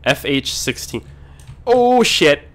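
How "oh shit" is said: high, loud startled curse; alarmed yelp. Real, not acted. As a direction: cry out at a sudden near miss. Near miss while driving.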